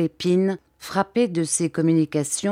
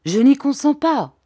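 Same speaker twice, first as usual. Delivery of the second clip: sharp, raised protest; vivement, éclat de voix; cry out sharply; 7 semitones higher, 4 dB louder than usual. A narrator's reading. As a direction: refuse him sharply, voice up. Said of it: "s'écria vivement Sir Hassel".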